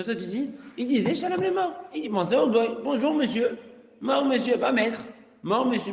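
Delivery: high, loud indignant lecture; teacher protesting the flattering greeting, high and loud; heated rant. Acted. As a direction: drive the point home with outrage. Rants against the trick.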